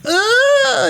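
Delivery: high squeaky laughing yelp; a tiny hammed-up scream; little scream. Rory letting out a little scream at the buzzsaw. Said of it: "and he's like".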